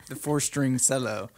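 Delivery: cello as SELL-o, amused; joking Kentucky pronunciation. Pretend. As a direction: play along with an S in cello. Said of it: "If you're in Kentucky".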